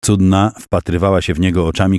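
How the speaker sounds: usual speaking voice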